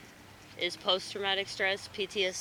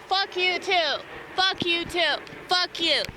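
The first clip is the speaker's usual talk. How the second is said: yelling; 9 semitones higher, 6 dB louder, slower than usual. Real, not acted. Yelling at a plane.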